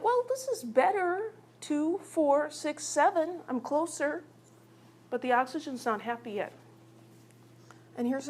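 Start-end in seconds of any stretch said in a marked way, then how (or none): 0.0-4.2 high-pitched, pleased little atom. Acted. Oxygen counts its electrons and gets closer, high atom voice. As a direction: count up hopefully in a high character voice. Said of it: "This oxygen's like".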